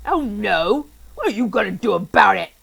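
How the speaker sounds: fast, loud mock horror; mocking laugh. A narrator's reading. Mister Gum mocking Polly, fast taunting. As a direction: taunt her with fake alarm. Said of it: "laughed Mister Gum".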